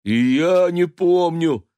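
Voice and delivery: high, loud, slow howl. Acted. Right neighbour cat howling along, mock howl. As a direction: wail it like a cat. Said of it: "подвыл сосед справа".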